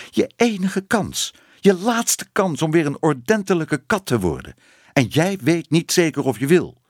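high-pitched indignant scolding. A narrator's reading. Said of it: "Tante Moortje ging verontwaardigd". (verontwaardigd uitvarend met hoge stem)

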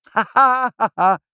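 high, loud ha-ha laugh; child's bright burst of acted laughing; acted laughter. A narrator's reading. Child laughs at mice in the soup, loud and high. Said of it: "lachte Ibbeltje".

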